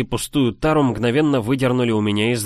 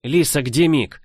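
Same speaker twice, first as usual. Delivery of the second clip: urgent pleading question; 5 semitones higher than usual. Acted. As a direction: press her for an answer. Desperate question to girl.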